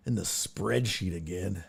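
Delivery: ordinary delivery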